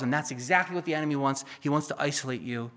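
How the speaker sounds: ordinary talk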